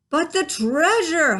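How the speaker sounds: slow, high, loud dismayed holler; captain hollering. A narrator's reading. Captain hollers in dismay to crew. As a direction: wail for the lost treasure. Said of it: "hollered Braidbeard".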